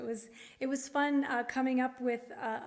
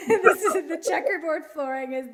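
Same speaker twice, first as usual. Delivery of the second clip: 笑いながら; 2 semitones higher, 8 dB louder, faster than usual. Real, not acted. Laughing while showing the song.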